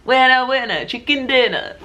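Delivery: put-on, drawn-up celebratory voice; playful announcer catchphrase. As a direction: crow it like a silly winner's catchphrase. Playful catchphrase after announcing a winner.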